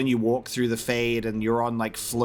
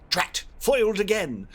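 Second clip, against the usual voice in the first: mock villain curse, pitched higher; higher-pitched mock villain frustration; thwarted cartoon villain; 7 semitones higher than usual. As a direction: curse like a foiled villain, playfully hammed-up. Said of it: "he's like that villain".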